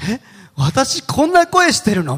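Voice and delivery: high, loud, drawn-out mock astonishment; shocked disbelief. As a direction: act out stunned disbelief, slow and high. Acts shock at hearing own recording.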